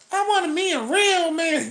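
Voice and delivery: high, sassy woman impression; high mocking woman voice.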